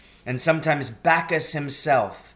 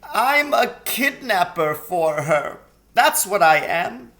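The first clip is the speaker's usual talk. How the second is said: tearful faun; 6 semitones higher, 5 dB louder than usual. A narrator's reading. Tumnus confessing.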